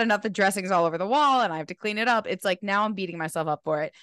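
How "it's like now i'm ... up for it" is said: ordinary delivery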